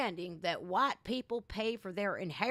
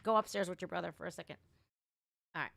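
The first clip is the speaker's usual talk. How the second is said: ordinary talk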